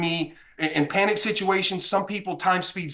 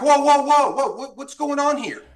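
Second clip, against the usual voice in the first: high, louder burst of whoas; retold alarm; 8 semitones higher, 5 dB louder than usual. Appraiser to man holding a gun, alarmed. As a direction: re-enact being startled, pitch jumping up.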